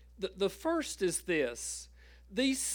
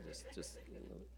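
breathy whispered aside, much softer; 10 semitones lower, 19 dB quieter, much faster than usual. Shown as a demonstration. Pastor whispering an aside to the congregation. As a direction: drop to a quick whisper.

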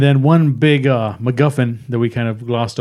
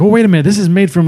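higher, louder acted realization; excited realization; 6 semitones higher, 4 dB louder, much faster than usual. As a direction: voice a sudden idea, pitched up. Acting out Shuri's sudden realization.

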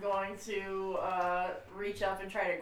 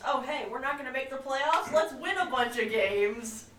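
mock the team's clueless cheer; 6 semitones higher, 6 dB louder than usual. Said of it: "they're doing what they always do, which is".

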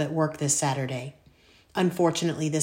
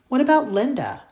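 whiny protest; 9 semitones higher, 6 dB louder than usual. Acted. Son protests to his mom.

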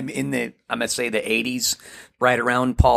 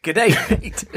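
quick Australian "Good day, mate" pitched up; mock Australian; 6 semitones higher than usual. Guy mocking an Aussie talker, Australian accent. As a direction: toss off a jokey Aussie greeting.

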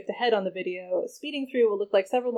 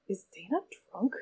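whispered; 6 dB quieter than usual. Acted. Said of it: "Ava whispered".

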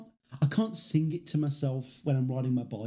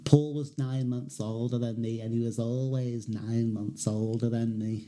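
slow, lower Scouse voice; 4 semitones lower, slower than usual. Retold or imitated. Doing a Beatle quote for his co-host, slow Scouse.

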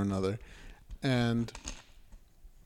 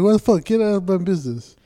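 higher, louder imitation of a snapping teacher; retold angry outburst; 6 semitones higher, 7 dB louder than usual. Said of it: "She's like".

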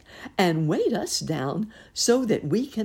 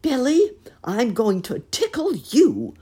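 loud, high, jolly; jolly laugh; 10 semitones higher, 8 dB louder than usual. A narrator's reading. Uncle Wiggily threatening to tickle the goat, jolly. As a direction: laugh it out playfully. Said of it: "laughed Uncle Wiggily in his jolly".

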